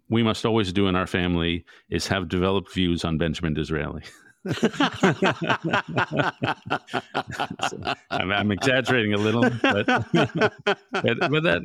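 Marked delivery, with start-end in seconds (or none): 4.4-7.6 a long, high burst of laughter; bubbling laughter; real laugh. Real, not acted. Laughs at own answer. 9.6-11.6 high, laughing words; giggling mid-sentence. Real, not acted.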